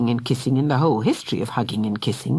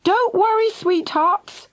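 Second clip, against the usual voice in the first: calling out; slower than usual. A narrator's reading. Missus Thunder calls down to her trapped children. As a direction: call reassurance through the rubble. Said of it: "Then she called".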